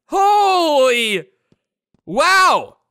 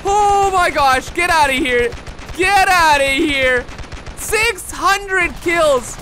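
usual speaking voice

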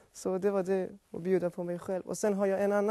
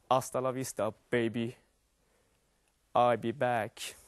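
Arnold Schwarzenegger; 8 semitones lower than usual. Retold or imitated. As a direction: growl it like the Terminator. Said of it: "från Arnold Schwarzenegger".

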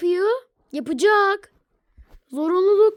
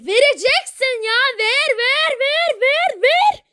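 whiny nagging; 7 semitones higher than usual. Acted. Çocukça tekrar eden ısrar.

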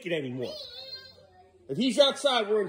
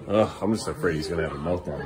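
usual speaking voice

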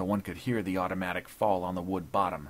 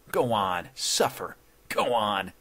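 loud, gloating taunt; chuckling taunt; 4 dB louder, slower than usual. A narrator's reading. Intruder enjoying the judge's pain, gloating taunt. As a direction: goad him with relish. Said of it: "chuckled Dodd".

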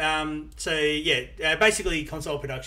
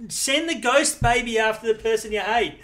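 higher, louder, selling the idea; emphatic pitch; 7 semitones higher, 6 dB louder than usual. Acted. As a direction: pitch it like a genius plan.

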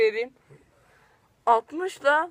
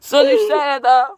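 laughing; 3 semitones higher, 8 dB louder than usual. Real, not acted. Laughing protest over more candy.

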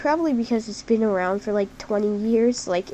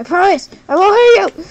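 much higher, louder desperate promise; loud pleading shout; 9 semitones higher, 15 dB louder than usual. Real, not acted. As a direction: yell after the fleeing fox. Pleading with the fleeing fox.

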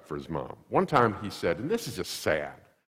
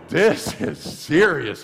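slow, grave, much higher and louder; mock grave warning; serious face; 7 semitones higher, 6 dB louder, much slower than usual. Shown as a demonstration. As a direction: show the mom's stern serious voice. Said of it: "not angry face, not belligerent face".

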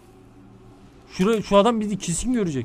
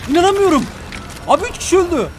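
shocked outburst; 7 semitones higher than usual. Real, not acted. Sudden deaths in the game.

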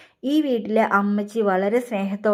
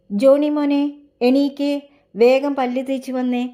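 raised, higher motherly wake-up call; calling to wake someone; 5 semitones higher, 5 dB louder than usual. Acted. Mother rousing her schoolboy son for breakfast, calling out. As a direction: call out to rouse a sleeping son.

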